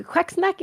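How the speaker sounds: "quacksnack" said the Swedish way; Swedish pronunciation. Shown as a demonstration. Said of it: "I say it in Swedish".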